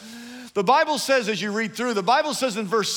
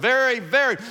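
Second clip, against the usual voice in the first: "very, very" higher and louder, each stressed; 5 semitones higher, 4 dB louder than usual. Shown as a demonstration. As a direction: punch both "very". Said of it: "even more important".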